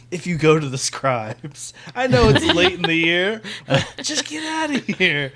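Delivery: high, loud, hammed-up urging; mock pleading. Host imitates Dane pushing someone to leave, mock urgent.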